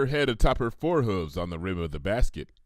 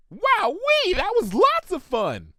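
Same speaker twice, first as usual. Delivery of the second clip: very high, chirpy Rainbow Dash voice; high chirp; 5 dB louder, slower than usual. Acted. Pony falling from a tree chirps to Applejack, very high and bouncy. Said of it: "she chirped in a register much higher".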